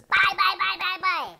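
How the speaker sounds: high, loud bickering monster head; two bickering giant voices. Pretend. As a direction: sing out bye bye as a giddy giant head. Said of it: "two-headed monster. One head says".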